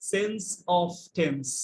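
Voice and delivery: sense of tense drawn out, word by word at a crawl; very slow. Shown as a demonstration. Teacher to class, explaining sentence very slowly. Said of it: "এটার মানে হচ্ছে".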